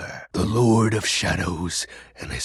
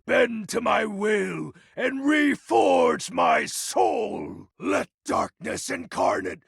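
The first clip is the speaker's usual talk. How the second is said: much higher, slow, shouted summoning; booming invocation; slower than usual. Acted. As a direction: call the darkness to you, roaring. Ritual invocation to transform.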